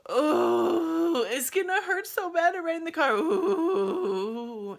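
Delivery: loud, high moaning wail; wailing in labour. Retold or imitated. Labouring mother dreading the car, loud pained wail. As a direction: wail the words through a contraction.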